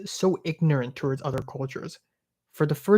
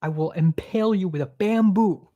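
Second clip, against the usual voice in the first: mock retort; 6 semitones higher, slower than usual. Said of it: "I'm like".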